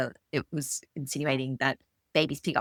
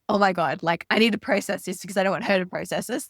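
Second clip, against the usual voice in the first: mock alarm; 4 semitones higher, 6 dB louder than usual. Imagined mum in rage.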